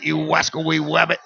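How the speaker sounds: lisping cartoon hunter; Elmer Fudd. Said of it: "Elmer Fudd".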